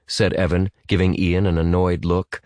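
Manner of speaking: ordinary delivery, nothing marked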